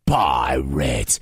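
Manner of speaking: very low, slow giant voice; bellowing giant. Acted. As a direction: drag it out in a deep giant rumble. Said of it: "he bellowed".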